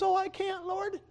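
high, thin reluctant voice; timid young woman. Acted. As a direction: shrink back from the call. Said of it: "And she says".

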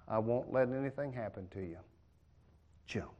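put-on calm the child into jumping; reassuring parent.

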